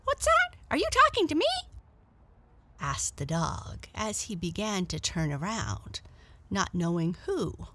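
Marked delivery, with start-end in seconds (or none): 0.0-1.5 very high, loud startled yelp of a question; dog spun round by a sudden voice behind him. Acted. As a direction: jump at an unseen voice. Startled dog turns around.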